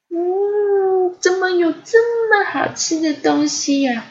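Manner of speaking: high, long savouring hum, then amazed; delighted first taste. A narrator's reading. Ponyo tasting honey for the first time, delighted. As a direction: voice a high-pitched delight at something delicious.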